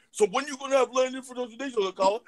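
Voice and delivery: high-pitched imitation of a skeptic; mock challenging questioner. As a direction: imitate someone demanding an answer.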